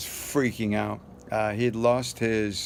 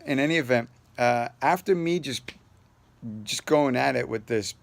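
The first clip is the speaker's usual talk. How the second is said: ordinary delivery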